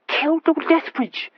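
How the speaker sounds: high, slow, horrified; shuddering. A narrator's reading. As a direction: shudder through the words. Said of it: "shuddered Horatio".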